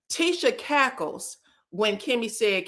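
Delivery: normal talking voice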